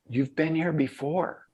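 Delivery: "You've been here before" lifted high and soft, half asking; questioning statement. Retold or imitated. Young girl at the hotel to the returning veteran, "You've been here before" as a question-statement. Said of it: "sort of a question statement".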